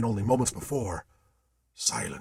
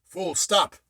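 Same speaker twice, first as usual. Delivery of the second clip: higher, louder command; cried order; 7 semitones higher, 3 dB louder than usual. A narrator's reading. Captain halting the ship, a cried order. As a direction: call the order. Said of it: "cried Captain Marchambeau".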